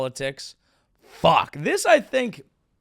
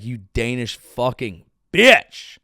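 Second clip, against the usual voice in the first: mock bully snarl; 3 semitones lower, 7 dB louder, slower than usual. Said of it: "they would basically be like".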